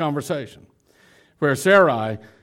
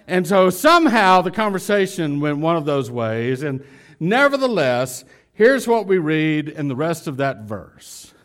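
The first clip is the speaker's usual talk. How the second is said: ordinary talk, nothing marked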